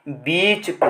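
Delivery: बीच stretched with a long ee; long vowel. Shown as a demonstration. Teacher confirming the long sound, long ee in बीच. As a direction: draw out बीच before पे. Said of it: "तो ये long sound हो गया".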